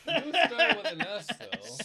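high, loud laughing words. Real, not acted. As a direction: laugh it out loudly. Laughing at a bad guess.

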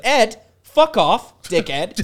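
loud, high, drawn-out rebuke; retold telling-off. Comic retelling of warning Ed off his wife, angry. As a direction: tell him off slowly and loudly. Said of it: "I said".

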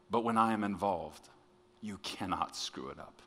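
soft, tender reassurance; very sweet. Retold or imitated. God reassuring a worried preacher, very sweetly. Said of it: "very sweetly say".

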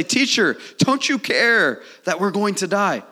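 high, loud frantic appeal; acted panicked plea; desperate disciples' cry. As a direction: cry out to wake the teacher. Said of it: "That's what the disciples are going through".

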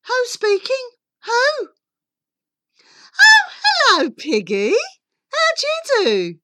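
very high, loud phone voice; high-pitched, effusive lady on the telephone. Acted. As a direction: gush a greeting in a piping society voice.

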